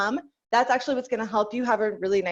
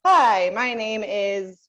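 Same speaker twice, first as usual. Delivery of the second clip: slow chest voice; 5 dB louder, much slower than usual. Shown as a demonstration. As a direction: say it slowly in chest voice. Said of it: "talk to say".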